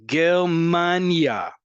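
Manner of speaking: Germania, loud and stretched on MAN; stress on Germania's second-to-last syllable. Shown as a demonstration. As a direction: punch and lengthen MAN in Germania. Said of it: "indicate where the emphasis".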